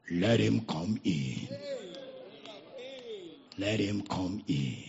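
put-on deep, slow old man's call; low slow voice from inside; old Welsh voice. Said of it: "Welsh voice just sounded".

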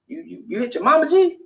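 can't believe he hit his mom; shocked disbelief. Retold or imitated. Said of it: "I was just".

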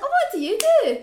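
hammed-up, very high, loud squeal; mock clueless small talk. Mocking herself for not knowing the manager, hammed-up eager. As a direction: send yourself up in a loud falsetto chirp.

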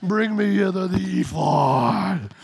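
playful Vader-style rumble; Star Wars voice. Retold or imitated. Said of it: "It just sounds Star Wars-like".

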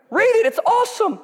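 preacher hamming up Elijah's mockery of the prophets; laughing full-force preaching. Real, not acted. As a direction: shout and laugh through the taunts.

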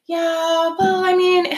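high, sung-out hesitant yeah; hesitant singsong friend. Retold or imitated. Friend hedging about her birthday, hesitant singsong. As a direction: drag it out like a reluctant friend.

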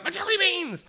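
a panicked junkie wailing for his stash; freaking out. Retold or imitated. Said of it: "He's just freaking out".

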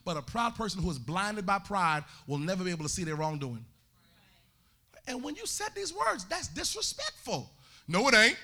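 usual speaking voice

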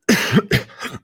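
sudden dry cough; coughing fit. Real, not acted. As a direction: cough hard mid-sentence. Coughing mid-sentence.

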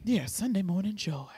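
whispered. Real, not acted. Echoed in whisper.

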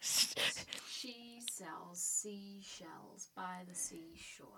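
slow, careful whispered tongue twister. Shown as a demonstration. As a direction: whisper it slowly, word by word.

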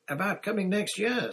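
put-on high, hopeful child's voice; keen youngster butting in; eager children chiming in. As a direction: pipe up eagerly, pitched high. Two children chime in eagerly.

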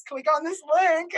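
loud, high mock old lady, laughing; hammed-up old-lady sales pitch through laughter. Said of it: "Is that the old lady voice?".

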